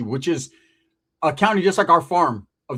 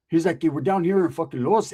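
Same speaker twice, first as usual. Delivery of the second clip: ordinary talk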